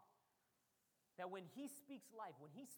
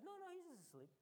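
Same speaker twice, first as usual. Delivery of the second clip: higher, softer, unbothered; breezy reassurance; 8 semitones higher, 5 dB quieter, faster than usual. Retold or imitated. Jesus breezily reassures the worried disciples, higher and softer. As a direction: wave it off lightly, higher up. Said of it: "And Jesus says".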